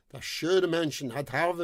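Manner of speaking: plain reading voice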